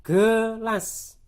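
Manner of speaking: "gelas" loud and split, guh-las; sounded-out schwa. Shown as a demonstration. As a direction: sound out "gelas" in two syllables with a schwa first. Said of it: "tulisannya dibaca gelas".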